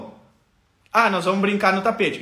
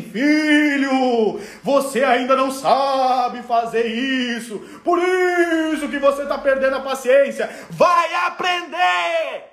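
voice of God; 2 semitones higher, slower than usual. Retold or imitated. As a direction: proclaim it like a message from heaven. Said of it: "mensagem do Papai do Céu".

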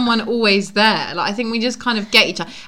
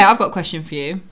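usual speaking voice

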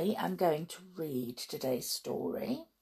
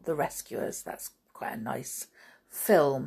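ordinary delivery

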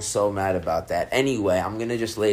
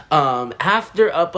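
normal talking voice

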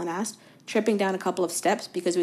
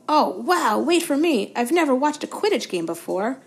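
put-on higher, louder, breathless boyish fan voice; eager young Colin; 5 semitones higher, 8 dB louder than usual. A narrator's reading. Colin bursts in to show Harry his photo, eager and high. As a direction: rush it out like an adoring kid.

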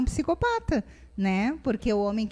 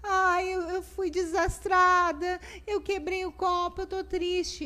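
put-on higher, a child sulking over a broken glass; mother imitating her daughter's sad little voice; upset little girl; 6 semitones higher than usual. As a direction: play the sad daughter, lightly. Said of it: "a Luma, às vezes".

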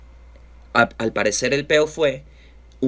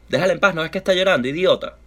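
fast, higher-pitched scolding in one breath; quick retold rebuke; 4 semitones higher, faster than usual. Repeating what he told his friend, rapid scolding. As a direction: rattle off the telling-off without shouting.

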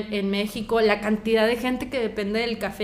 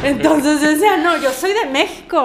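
higher, louder, through a laugh; laughing retelling; 5 semitones higher, 6 dB louder than usual. Real, not acted. Laughing that nobody would believe her, amused retelling. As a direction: tell it while breaking into laughter.

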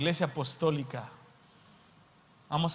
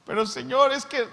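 high, louder protest; pleading Peter; 9 semitones higher, 7 dB louder than usual. Acted. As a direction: cut in with a guilty plea.

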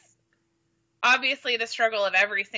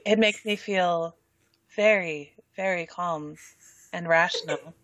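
sexy voice; 3 semitones lower than usual. Shown as a demonstration. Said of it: "you have to say it in the sexy voice".

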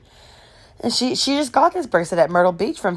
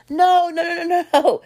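high, rapid string of refusals; emphatic brush-off; 12 semitones higher than usual. Retold or imitated. Friends overruling her objection, hammed-up insistence. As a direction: wave the protest away in a high voice. Friends acted waving off protest.

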